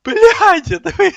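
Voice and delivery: loud shocked yell at the screen. Real, not acted. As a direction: blurt it out at full volume. Shouting at teammates in game. (громкий крик)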